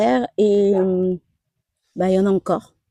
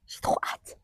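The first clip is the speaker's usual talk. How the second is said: whispered excitement; 12 dB quieter than usual. Real, not acted. Eager about the flowers.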